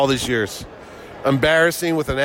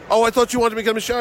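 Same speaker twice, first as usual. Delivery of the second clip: higher and fast, caught-out backpedal; mock the guest realizing his mistake; sheepish retreat; 7 semitones higher, much faster than usual. Embarrassed guest backpedaling to the promoter, high fast. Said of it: "He goes".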